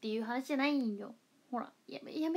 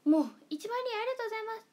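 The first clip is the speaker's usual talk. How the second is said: high, fast burst of joy at ten thousand; excited thanks; 10 semitones higher, 6 dB louder, much faster than usual. Real, not acted. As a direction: blurt it out thrilled and quick. Reaching ten thousand viewers. (一万人に大喜びで早口の高い声)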